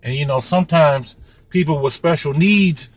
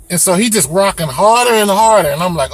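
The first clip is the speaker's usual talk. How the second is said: ordinary talk, nothing marked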